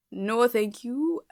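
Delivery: stretched, insistent polite refusal; mock refusal. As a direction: refuse it slowly and emphatically, lifting at the end. Mock refusal of birthday wishes.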